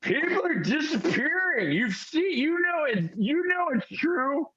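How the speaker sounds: high, slurred, insistent; old drunk pleading that people vanish. Acted. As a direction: beg like a frightened drunk. Drunk Randy pleads with barman.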